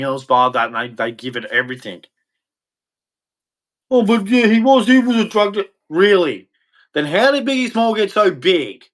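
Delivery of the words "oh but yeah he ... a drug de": high-pitched parody of protest; mocking imitation. Imitating a fan defending [name], high mocking voice. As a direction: mimic a fan rushing to his defence.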